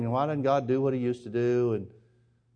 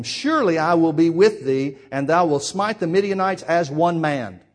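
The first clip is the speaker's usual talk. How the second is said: slow, loud solemn promise; slow, loud divine reassurance; voice of the Lord; 3 semitones higher, 6 dB louder than usual. Acted. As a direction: speak it slowly as God reassuring.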